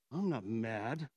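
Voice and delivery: very low, grudging denial; angry denial. Shown as a demonstration. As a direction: sink right down low and deny being angry. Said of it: "I can say the exact same words".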